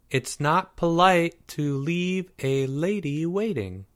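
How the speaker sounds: high, slow, drawn-out teasing voice; prim girl. A narrator's reading. Girl chiding late Porge over walkie-talkie, prim. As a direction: lilt it with mock-prim reproach.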